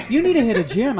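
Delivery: high, loud, laughing jab; mocking laugh while scolding. Real, not acted. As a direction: tease him through a laugh. Mocking the lazy guy.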